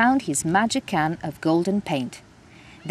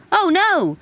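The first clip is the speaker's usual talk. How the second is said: shouted; 11 semitones higher, 4 dB louder than usual. Acted. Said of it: "he shouted".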